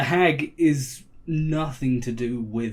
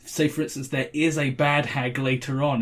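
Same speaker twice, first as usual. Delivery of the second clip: ordinary delivery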